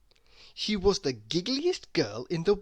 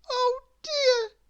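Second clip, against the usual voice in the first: very high, crying little voice; miserable little girl; 5 dB louder than usual. Acted. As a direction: whimper it out in dismay. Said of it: "she thought miserably".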